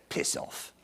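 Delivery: hushed, curt brush-off; older dog's dismissal. Acted. Eddie dismissing the young puppy, hushed brush-off. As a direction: send the puppy away under your breath. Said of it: "And Eddie says".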